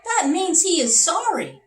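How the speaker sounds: high, excited king; king's voice. Acted. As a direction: squeak it out like an excited little king. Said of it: "said the king".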